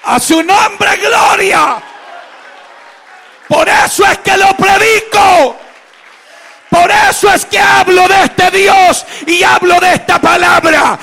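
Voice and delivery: high, shouted preaching with drawn-out key words; prédica a pleno pulmón. Real, not acted. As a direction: proclaim it to the congregation at full force. Preaches at full force. (predicación exaltada, gritada y aguda)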